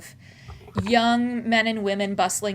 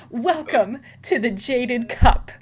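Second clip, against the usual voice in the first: puffed-up host's welcome, louder and higher; proud sales spiel; 5 semitones higher, 7 dB louder than usual. Acted. Tea house worker gives newcomers the spiel, proud and loud. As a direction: announce it grandly, stretching the welcome. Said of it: "he puffs out his chest and he goes".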